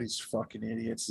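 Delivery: quiet, fed-up mutter; muttered aside. Acted. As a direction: mutter it in disgust. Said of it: "Santos just shaking her head".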